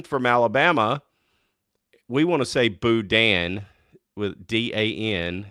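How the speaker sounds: normal talking voice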